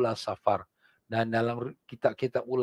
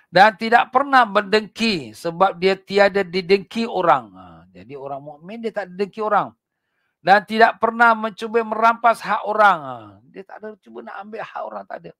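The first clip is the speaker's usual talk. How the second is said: fiery preaching; 8 semitones higher, 8 dB louder than usual. Acted. Preacher warning the congregation about hypocrites, fiery. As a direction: drive the warning home from the pulpit.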